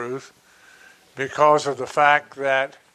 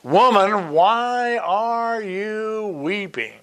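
high, slow, drawn-out scriptural declamation; Jesus questioning Mary; 6 semitones higher, slower than usual. Acted. Jesus asks the weeping Mary, high slow declaiming. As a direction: stretch the question out, pitched well up.

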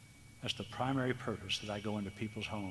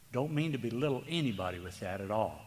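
ordinary delivery

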